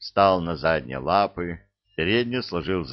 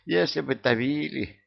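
sad sigh; 7 semitones higher than usual. Acted. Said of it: "грустно вздохнула Яга".

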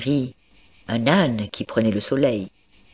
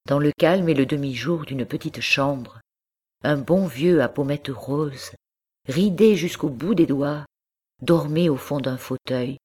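usual speaking voice

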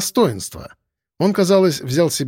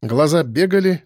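plain reading voice

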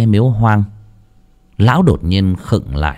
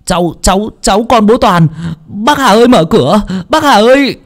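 put-on high, loud stammering boy; frantic child; 11 semitones higher, 4 dB louder than usual. Boy at the door begging old Hà to open, panicked. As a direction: stumble over the words in a panic. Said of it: "có tiếng láo nháo".

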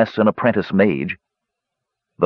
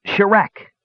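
high, ringing incantation; magic word; 12 semitones higher than usual. Acted. Apprentice mage speaking the word of light to his staff, incanting. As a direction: call the spell word out high. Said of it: "He spoke the magic word to the staff".

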